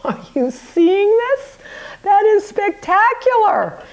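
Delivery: high, slow, delighted squeal; thrilled. Real, not acted. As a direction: gush over the cut tart, laughing. Reveals cut tart, thrilled.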